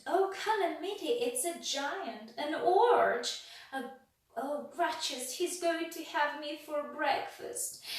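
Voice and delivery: high, loud hammed-up fright; panicky wailing. A narrator's reading. Mister Jelly fears the tramp will eat him, high panic. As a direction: flap and wail at the giant.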